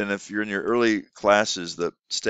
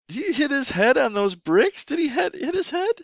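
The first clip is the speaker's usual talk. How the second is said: high, exaggerated sing-song; playful teacher question. Shown as a demonstration. Teacher asking a young student about the game, high and playful. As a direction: ask it like a teacher delighting a child. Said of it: "you can talk about".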